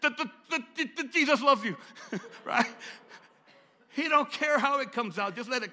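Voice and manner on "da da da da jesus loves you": loud stuttered da-da before the words; stammer, then push it out loudly; mock stutter breaking into the phrase; stuttering impression. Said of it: "He'll, he'll come out".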